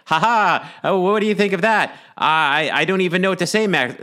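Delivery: high, loud manic cackling villain; mad scientist. Retold or imitated. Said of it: "he comes back and he's like".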